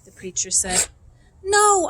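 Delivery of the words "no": sharp, loud refusal; defiant no. A narrator's reading. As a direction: plant your feet and refuse. Opal defying the preacher.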